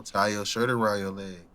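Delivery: low, hushed mystery voice; soft-spoken. Retold or imitated. A voice heard under the full moon, soft-spoken. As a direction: say it gently and low, barely projected. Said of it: "so soft-spoken".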